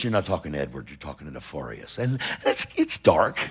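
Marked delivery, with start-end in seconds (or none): 0.0-2.0 much lower and much softer, a menacing demon growl; a deep, quiet demonic threat. Retold or imitated. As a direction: voice the possessed man like a demon. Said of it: "He goes".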